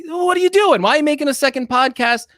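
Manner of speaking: high, incredulous what are you doing; mock outrage. Host mocks rival's new podcast, high incredulous outrage. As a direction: play it up as betrayed disbelief. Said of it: "And it's like".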